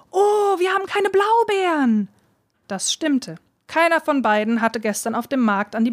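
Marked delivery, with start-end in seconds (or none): 0.1-2.1 high, slow cry of dismay; a girl suddenly realises the berries are missing. A narrator's reading. Dulcinea to her father, crying out in dismay. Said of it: "rief Dulcinea".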